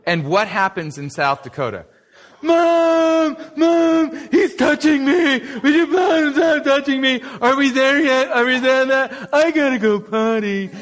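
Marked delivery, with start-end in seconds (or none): 2.4-10.7 put-on high, loud whiny kid; whiny tattling little sibling. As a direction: whine and tattle like a bratty kid. Kids whining in back seat.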